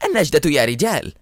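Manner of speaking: understated storyteller's shout; cry for help. A narrator's reading. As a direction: call the men to the rescue, restrained. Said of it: "صرخ مرة أخرى".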